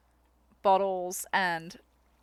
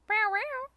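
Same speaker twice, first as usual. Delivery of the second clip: meowing at the cat; 10 semitones higher than usual. Real, not acted. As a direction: meow sweetly to call a cat.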